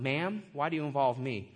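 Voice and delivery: low, understated respectful question; formal, polite. Acted. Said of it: "just a formal way to address".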